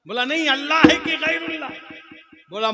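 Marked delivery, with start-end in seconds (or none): 0.2-1.7 very loud, high shout; insistent challenge. Acted. Sunni presses the cleric for an answer, insistent. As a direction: press the question at full volume.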